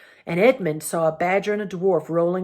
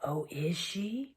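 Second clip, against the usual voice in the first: very soft, slow, menacing creature; gray, terrible voice; 11 dB quieter, slower than usual. A narrator's reading. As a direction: ask it slowly with quiet, dreadful menace. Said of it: "That gray and terrible voice".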